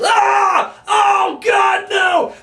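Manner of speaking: hammed-up wrestler selling pain; mock agony scream. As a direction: overact like a dying man. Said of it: "And he goes".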